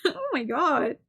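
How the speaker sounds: high, laughing outburst; cracking up. Real, not acted. Reader losing it over the banning line, laughing. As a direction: break up laughing at your own voice.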